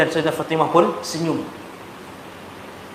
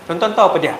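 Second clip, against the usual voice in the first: normal talking voice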